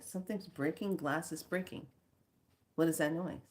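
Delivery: retold soft, wondering self-talk; hushed puzzlement. Speaker to self on hearing glass break, hushed puzzlement. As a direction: think aloud softly, listening out.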